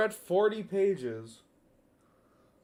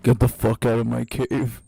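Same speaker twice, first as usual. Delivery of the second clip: louder, lower, snapping; sudden angry yell; 4 semitones lower, 9 dB louder than usual. Shown as a demonstration. Character abruptly turns on the visitor, louder and lower. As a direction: bark it out all at once. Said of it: "just immediately goes like this".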